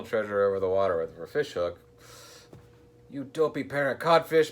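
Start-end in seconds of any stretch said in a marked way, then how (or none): 3.1-4.5 high, loud pirate captain's bellow. A narrator's reading. Captain scolding his crew over the fishing, bellowing. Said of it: "bellowed the captain".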